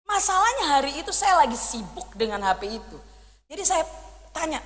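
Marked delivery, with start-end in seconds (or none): none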